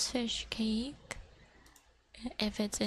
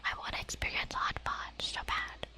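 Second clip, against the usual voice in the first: ASMR whisper. Acted. Whispering to viewers about fish cake. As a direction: whisper it close to the mic.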